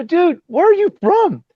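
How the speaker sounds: high-pitched, drawn-out disbelief; baffled retold question; astonished question. Retelling his astonished question.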